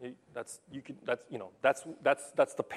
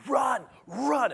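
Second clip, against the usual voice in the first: shouted high, loud command; mock yell; 11 semitones higher, 6 dB louder than usual. Angry coach yelling run at his players.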